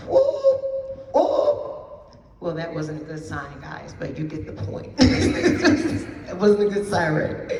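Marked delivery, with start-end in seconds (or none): 0.1-2.2 high, siren-like whoop then breathy echo; mock siren wail, sliding way up then fading to breath; alarm siren imitation. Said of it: "There is no warning sign that says". 5.0-6.2 amused laugh. Real, not acted. Laughs at own siren sound.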